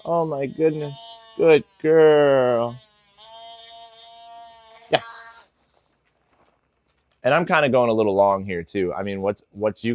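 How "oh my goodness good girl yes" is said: high, bright praise voice to the dog; praising the dog. Real, not acted. Trainer praising his dog Lola, high and cheerful.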